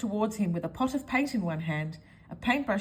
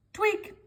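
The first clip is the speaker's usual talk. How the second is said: tweak sound; 12 semitones higher than usual. Acted. Sound effect of nose tweak.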